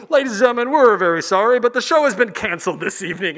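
loud, formal cancellation announcement; park announcer voice. Acted. Announcer cancelling the evening show, formal apology. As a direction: announce bad news to the whole park. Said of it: "you hear the little voice".

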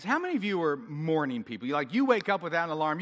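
usual speaking voice

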